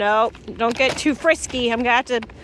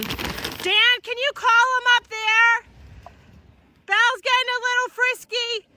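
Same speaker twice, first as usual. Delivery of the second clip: calling to husband; 9 semitones higher than usual. Real, not acted. Pregnant farmer calling her husband for help with a frisky cow, high call. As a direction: call out with worry over the cow.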